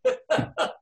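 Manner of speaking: high airy mock scream; a short excited shriek, half laughed; retold scream. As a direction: let out a breathy high shriek of glee. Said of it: "I screamed".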